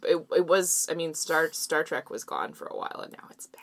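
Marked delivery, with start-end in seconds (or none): 1.5-3.6 dropped to a whisper. Real, not acted. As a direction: whisper it like a secret aside. Aside, voice drops to whisper.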